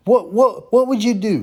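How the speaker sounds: retold heated demand at the gym; heated challenge. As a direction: confront her hard.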